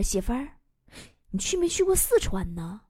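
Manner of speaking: low, soft, solemn question; mock serious. Husband sounding out his moody wife, mock serious. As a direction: ask with put-on gravity. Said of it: "我一脸严肃地问他".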